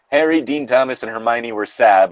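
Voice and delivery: ordinary delivery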